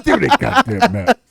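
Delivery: mock-urgent film quote; imitated demand. Quoting a map-snatching scene to a friend, demanding.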